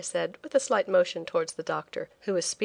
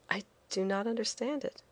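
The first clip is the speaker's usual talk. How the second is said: softer, held-back reply; quietly; 3 semitones higher, 7 dB quieter than usual. Acted. Nellie answers the agent quietly, masking her shock. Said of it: "I said quietly".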